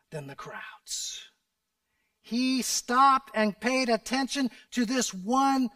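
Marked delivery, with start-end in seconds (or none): 0.1-1.2 very low, slow whisper. Real, not acted. Preacher stressing a point to the congregation, whispered. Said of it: "preacher drops to whisper".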